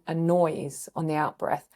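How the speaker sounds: normal talking voice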